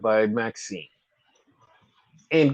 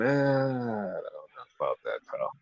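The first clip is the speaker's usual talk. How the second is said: skeptical tough guy; 6 semitones lower, 10 dB quieter than usual. Pretend. Skeptical put-on voice, answering fans.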